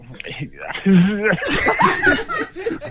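long, high, uncontrollable laugh; helpless laughter. Real, not acted. Shop man tries to answer the angry husband, helpless high laughter. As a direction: crack up while trying to stay professional.

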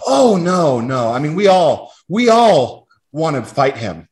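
high, soothing boss voice, imitated; a touch of a kindly manager; mock sympathetic manager. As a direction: play the boss sweetly consoling you.

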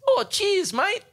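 very high, polite little heckler voice; imitated audience member. Audience member thanking comedian for moving his stool, high mock-polite voice. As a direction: pipe up high, as a thankful punter. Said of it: "someone just goes".

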